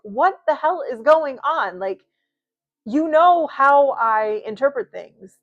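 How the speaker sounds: loud, high, slowed yell; exasperated yell at her guides. Retold or imitated. Venting at her spirit guides, loud exasperated yell. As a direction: throw it up at the sky in exasperation. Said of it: "yell and be like".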